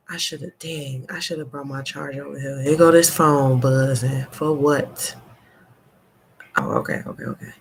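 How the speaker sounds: ordinary delivery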